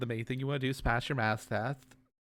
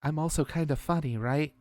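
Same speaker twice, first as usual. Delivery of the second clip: ordinary delivery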